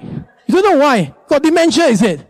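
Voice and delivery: high, exasperated mock berating; nagging scold. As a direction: scold a forgetful husband, hammed-up. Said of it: "you say this kind of thing".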